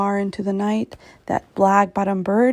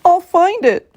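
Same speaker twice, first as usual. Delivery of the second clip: louder and higher, a vow; crying out; 8 semitones higher, 6 dB louder than usual. A narrator's reading. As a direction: cry it out with determination. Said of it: "cried Horton".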